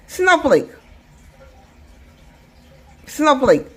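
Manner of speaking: "Snowflake" run together quickly as one beat; hurried one-piece "Snowflake"; too fast. Shown as a demonstration. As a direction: say "Snowflake" quickly, no gap between the parts. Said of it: "you're going too fast to sound like syllables".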